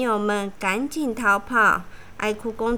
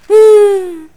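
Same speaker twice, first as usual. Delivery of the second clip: sudden high sobbing burst; loud sob; 7 semitones higher, 13 dB louder than usual. A narrator's reading. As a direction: howl briefly. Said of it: "躺着哭".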